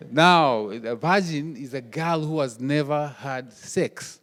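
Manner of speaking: ordinary talk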